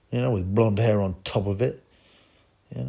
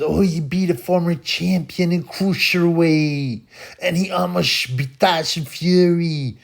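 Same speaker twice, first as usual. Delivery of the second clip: high, slow mocking imitation of a critic; mocking Bjorn; 7 semitones higher, 4 dB louder than usual. Mimicking the Swedish commenter, higher and slower. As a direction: sneer his words back in a higher, slower voice. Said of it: "He just says".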